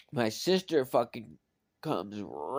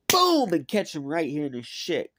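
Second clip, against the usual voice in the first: loud punch; 5 semitones higher, 7 dB louder than usual. Retold or imitated. Acting out the punch.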